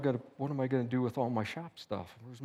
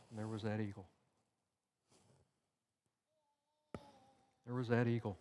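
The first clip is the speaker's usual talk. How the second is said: hushed; 2 semitones lower, 10 dB quieter, faster than usual. Real, not acted. Moment he sees the eagle leaving.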